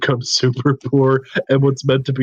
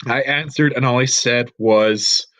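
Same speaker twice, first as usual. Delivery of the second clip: ordinary talk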